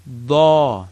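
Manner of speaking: "Da" said deep and full-mouthed; teacher modeling emphatic "Da"; heavy emphatic da. Shown as a demonstration. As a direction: make "Da" thick and heavy. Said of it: "Dad. Fatha, da".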